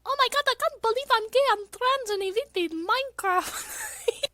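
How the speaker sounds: put-on very high, far brighter anime girl voice; Miku voice. Streamer granting chat's request in Miku voice. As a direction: squeak it out like a Vocaloid girl. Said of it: "Oh, the Miku voice!".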